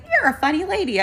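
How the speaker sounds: high-pitched imitation of an amused old lady; little old lady. Old lady to her helper, high and amused. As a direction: put on a tiny old lady's high voice.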